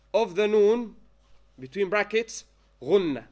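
drawn-out phrases with pauses to write; deliberate note-giving pace, key words stretched; slow dictation. Real, not acted. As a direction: dictate slowly, chunk by chunk. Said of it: "say slowly".

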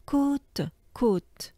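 côte said with an OO sound, /kut/, like the south of France; côte rhymes with boot here; southern French côte. Shown as a demonstration. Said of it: "they will pronounce côte".

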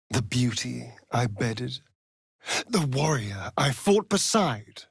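ordinary talk, nothing marked

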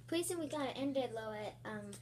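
ordinary talk, nothing marked

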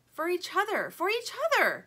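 high, loud joyful cry; excited cry. A narrator's reading. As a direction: cry it out bouncing with joy. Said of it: "cries Plastic".

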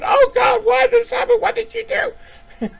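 very high, loud crying shriek; mock screaming mother. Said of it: "she's screaming".

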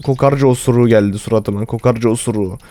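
ordinary delivery

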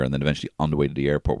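ordinary talk

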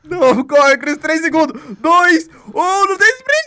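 high loud frantic counting; panicked countdown shout. Real, not acted. Countdown shouting during chase. (contagem gritada em pânico)

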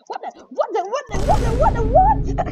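escalating gamer panic; building disbelief. Real, not acted. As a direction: stutter yourself into a shriek.

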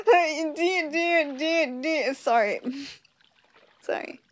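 stammering through giggles; giggling apology; laughing fit. Real, not acted. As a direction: try to talk but keep cracking up. Breaks down laughing mid-line.

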